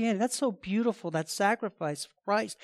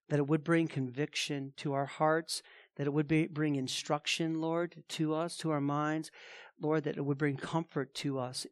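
softer, hushed pastoral prayer; 4 semitones lower, 8 dB quieter than usual. Acted. Pastor prays over the congregation before the sermon, hushed and earnest. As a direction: pray quietly and earnestly for the flock.

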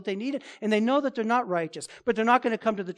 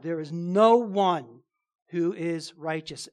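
NO ONE drawn out slowly; slow, grave emphasis on NO ONE; emphatic; 3 semitones lower, slower than usual. Shown as a demonstration. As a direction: weigh down NO ONE, then finish low and slow. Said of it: "that's very emphatic".

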